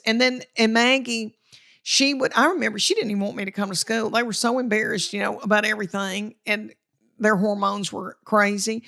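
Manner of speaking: normal talking voice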